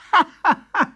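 high bright giggle; girlish giggle. Acted. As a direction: burst into a giggle. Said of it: "she started giggling".

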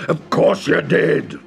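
slow roaring ogre. Acted. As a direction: roar it slowly. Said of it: "roared the first".

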